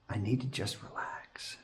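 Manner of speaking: soft whispered self-calming. Real, not acted. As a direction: breathe it out under your breath. Calming himself after rushing.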